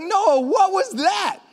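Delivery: high, slow, astonished; drawn-out high amazed ask; amazed question. Retold or imitated. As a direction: marvel at what he just did. Retold surprise at friend.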